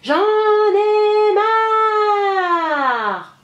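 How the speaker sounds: very slow, high, sing-song wail; a little girl wailing in a fit of temper; tantrum wail. A narrator's reading. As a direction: drag it out in a howling tantrum. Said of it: "Je crie".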